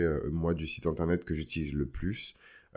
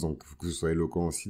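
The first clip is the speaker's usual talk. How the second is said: ordinary delivery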